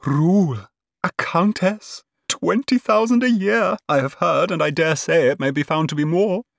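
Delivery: high, thrilled marvelling; breathed in awe. A narrator's reading. Cousin marvels at the rich match, awed. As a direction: savour each item, pitched high. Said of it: "she breathed".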